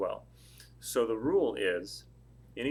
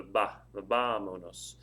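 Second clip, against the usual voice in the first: "ba" alone, then "vámonos" with a drawn-out first syllable; stress on first syllable; 2 semitones lower, slower than usual. Shown as a demonstration. Teacher pointing out the stressed syllable, "ba" isolated, then "vámonos" stressed at the start. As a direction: lean hard on the opening "ba" of "vámonos". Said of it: "The stress of the word is on the first syllable".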